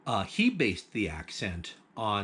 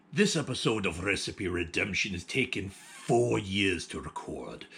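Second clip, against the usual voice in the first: put-on pirate accent. Host opening Talk Like a Pirate Day episode, hammed-up pirate accent. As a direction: growl it out like a stage pirate captain. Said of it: "I'm going to drop this accent now because I'm not good at it".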